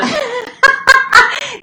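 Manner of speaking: big high burst of laughter; a real burst of laughter at the story; loud laugh. Real, not acted.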